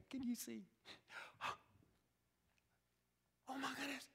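soft, breathless gasp of joy; hushed joyful disbelief; amazed father. Acted. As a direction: let it out in quiet wonder. Father recognises his son, amazed.

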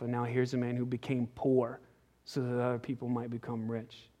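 low, soft, intimate sermon voice; hushed preaching. Real, not acted. Preacher to congregation about Zacchaeus, hushed and soft. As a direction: draw the congregation in with a hush.

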